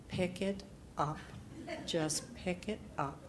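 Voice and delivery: soft inner command; quiet self-urging. Retold or imitated. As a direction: nudge yourself under your breath. Self-talk pep order, quiet.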